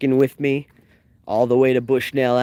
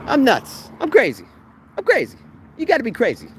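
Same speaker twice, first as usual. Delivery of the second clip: giddy excitement; 12 semitones higher than usual. Real, not acted. Repeating crazy, voice climbing.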